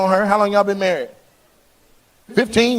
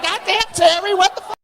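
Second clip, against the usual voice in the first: high-pitched "Goddamn, Terry"; squeaky disbelieving friend; imitated exasperated friend. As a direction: play the fed-up buddy. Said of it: "He was like".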